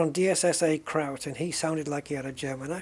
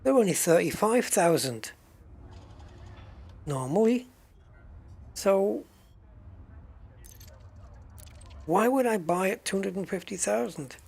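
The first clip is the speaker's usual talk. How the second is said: indignant haggling; 4 semitones higher than usual. Real, not acted. Arguing the price with the trader.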